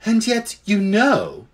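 slow, high lady elephant; ponderous she-elephant. A narrator's reading. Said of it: "said the elephant".

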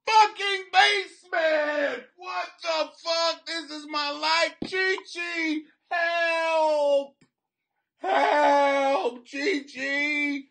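high, stretched-out wailing shout; hammed-up cry of despair; mock desperate plea. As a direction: wail for help, dragging the words out. Mock plea to escape basement.

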